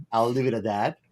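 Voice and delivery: ordinary talk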